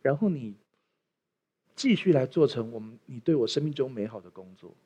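soft, low prayer voice. Acted. Pastor praying softly over the congregation, hushed prayer. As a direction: pray quietly to close.

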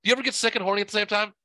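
usual speaking voice

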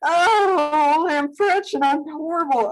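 can't stop laughing while trying to talk; helpless giggles. Real, not acted. Host mocking own botched pronunciation, helplessly giggling. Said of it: "I can't stop".

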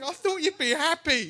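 mock bewilderment in a high voice; puzzled mate. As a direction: imitate a baffled friend, pitched right up. Acted puzzled friend's thought.

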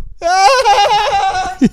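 long, high mock scream; sustained high-pitched prank wail. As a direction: keep screaming high to startle people. Demonstrates scream while holding button.

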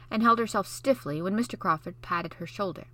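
ordinary delivery, nothing marked

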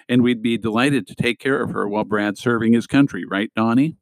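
plain reading voice

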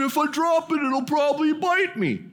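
high, dopey admission of a deadly catch; Dan's voice. Retold or imitated. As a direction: play the dim jock realizing it's venomous. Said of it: "that's how he talked".

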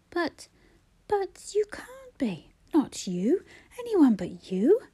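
high, breathless whisper of disbelief; stunned denial. Acted. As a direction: refuse to believe it. Brother hears she is dying.

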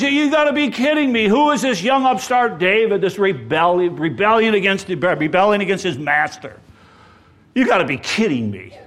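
loud, contemptuous scoffing at David; scornful outburst. Retold or imitated. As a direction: sneer at the upstart. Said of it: "he says".

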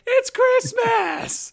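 very high, drawn-out mock squeal; screaming little girl. Host imitating the little girl pointing at the ship, high drawn-out squeal. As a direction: mimic an excited little girl, stretching it out. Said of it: "the little girl that's just screaming".

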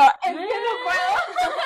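high, slow tearful wail; hammed-up blubbering, climbing into a squeal; mock crying. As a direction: wail it as if breaking into sobs. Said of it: "vi a Pau llorando y yo".

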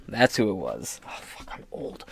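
unvoiced aside, rueful; whisper to self. Real, not acted. Host realizing his age, whispering to himself. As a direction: whisper it like a secret shame.

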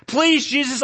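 high, loud, desperate plea; begging. Acted. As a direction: beg at the top of the voice. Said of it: "I begged".

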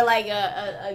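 ordinary talk, nothing marked

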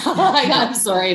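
laughing it off in disbelief. Real, not acted. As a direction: laugh through an incredulous refusal. Laughing off the idea of coping.

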